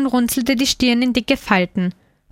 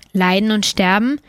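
plain reading voice

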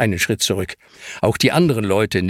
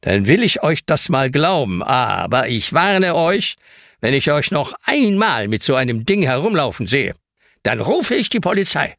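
stern caretaker, higher voice, slowing on the warning; 7 semitones higher than usual. Acted. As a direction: warn the children firmly. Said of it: "Aber er ist immer noch streng".

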